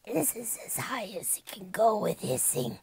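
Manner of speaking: slow, hissed creature voice; high-pitched hiss. Shown as a demonstration. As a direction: squeeze the words out as a hiss. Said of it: "not sure if I could do anything more".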